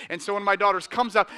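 ordinary talk

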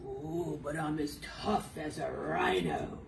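a hint of tough rhino; lightly tough voice.

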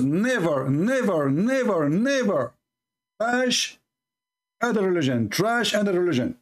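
a mock preacher repeating never with rising pitch; mocking the pastor. Commentator mocking a pastor's sermon, pitch rising on each never. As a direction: ham up the pastor's never, never line. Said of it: "He said to his followers".

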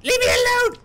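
very high, loud panicked shriek; panicked screaming. Real, not acted. Player flees a scare into the hole, panicked screaming. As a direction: scream it while fleeing.

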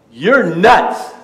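loud scoffing "You're nuts"; mock dismissive outburst; scoffing retort. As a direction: scoff at the nonsense, loudly. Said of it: "you would say".

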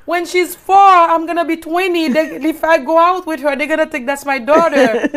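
put-on high, loud, fretting teen voice; worried teen daughter. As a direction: daughter fretting that people will think the baby is hers.

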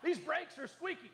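high, laughing complaint; mock complaining customer. Customer complaining about brakes, mock whiny. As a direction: whine the complaint through a laugh.

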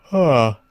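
long loud yawn with a sigh. Real, not acted. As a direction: let out a big real yawn. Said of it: "I'm yawning".

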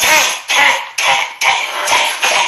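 sharp clicked tat sounds like a slap echoing; imitated slap echo. Mimicking the slap echoing down the hallway, clicked tats. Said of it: "echo through the hallway".

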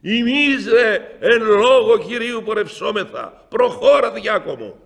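high, loud victorious proclamation; triumphant shout. Retold or imitated. Elder urging the deacon forward, triumphant loud shout. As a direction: ring it out like a battle cry. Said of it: "φωνάζει με έναν ύφος θριαμβευτικόν, νικητή".

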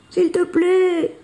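high, drawn-out plea; exhausted cry. A narrator's reading. Billy begs the galloping bison, crying out exhausted. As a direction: beg desperately with your last strength. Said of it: "crie Billy à bout de force".

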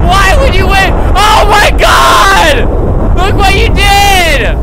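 high, loud, drawn-out yelling; mock outrage. Genie blaming the player who wished for the nuke, mock outraged yell. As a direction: wail the blame at the top of your voice.

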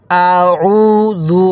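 "আউযু" with unstretched আ and long held উ; short hamza, long vowel. Shown as a demonstration. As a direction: keep আ short, stretch the উ in "আউযু". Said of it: "হামজার মধ্যে কিন্তু টান দিবেন না".

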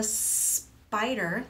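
SPIDER with a long hissed S before the rest; drawn-out snake sound. Shown as a demonstration. Teacher modelling a word for children, drawn-out S. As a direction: stretch the S at the start of spider. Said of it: "let's say that word again".

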